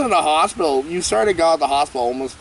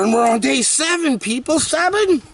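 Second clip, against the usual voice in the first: excited; 11 semitones higher than usual. Real, not acted. Excited day count to viewers.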